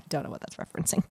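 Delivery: quiet unvoiced aside; whispered comment; muttered aside. Real, not acted. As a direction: mutter to yourself. Aside during the reading.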